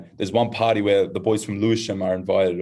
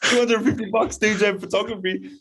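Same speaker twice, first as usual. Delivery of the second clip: put-on high excited girlish voice; a thirteen-year-old girl gushing; 12 semitones higher than usual. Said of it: "She goes, "What?".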